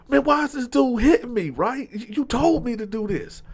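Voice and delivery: indignant confusion, pitched well up; acted confusion. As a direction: replay your baffled complaint. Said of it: "I'm kinda confused".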